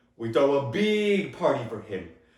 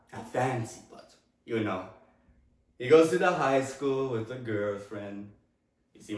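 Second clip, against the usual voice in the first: normal talking voice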